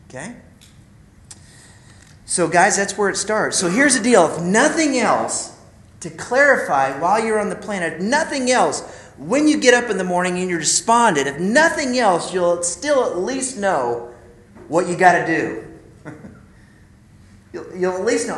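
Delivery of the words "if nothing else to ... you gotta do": higher, louder repeated refrain; preaching. Real, not acted. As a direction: hammer the refrain home. Preaching repetition.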